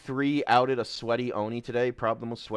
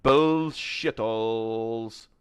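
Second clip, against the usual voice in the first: slow, stretched playful jeer; stretched-out mock jeer; drawn-out jokey word; 3 dB louder, much slower than usual. Real, not acted. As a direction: drag the word out mockingly.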